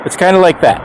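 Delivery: ordinary talk, nothing marked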